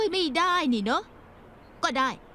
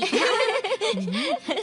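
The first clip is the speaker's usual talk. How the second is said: laughing; 5 semitones higher than usual. Acted. Friends teasing her about weight.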